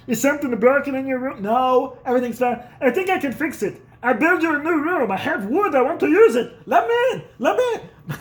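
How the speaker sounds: high, loud mock eager dad; hammed-up father desperate to fix something; dad pleading to fix his son's room; dad begging.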